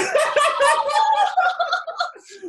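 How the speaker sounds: high loud burst of real laughter. Real, not acted. Cracking up after the woo cheer, real loud laughter. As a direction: let a big laugh burst out.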